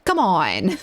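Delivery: ordinary delivery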